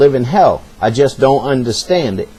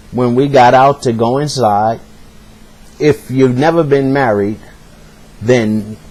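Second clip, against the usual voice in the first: normal talking voice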